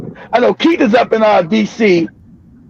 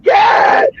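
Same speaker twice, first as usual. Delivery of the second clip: high shocked cry of God; stunned exclamation, pitched high. Retold or imitated. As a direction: throw the word way up in disbelief.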